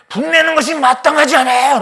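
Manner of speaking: loud, high, fast talking back; defiant retort. Acted. Jonah talking back to God, loud fast retort.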